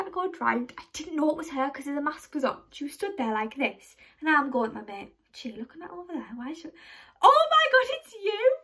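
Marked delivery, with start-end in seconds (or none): 4.7-6.7 whispered aside. Retold or imitated. Wondering aloud who is staring, whispered. Muttering about staring stranger. 7.2-8.6 high, loud surprised exclamation; surprised shriek. Retold or imitated. Realising the masked girl is Lucy, high surprised squeal.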